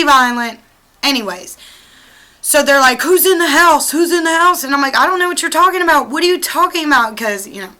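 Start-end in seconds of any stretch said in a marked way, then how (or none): 3.0-4.6 retold police demand. Police officers demand at car window. 5.0-7.2 fast, higher, playing dumb; rushed denial. Denying to police, playing dumb.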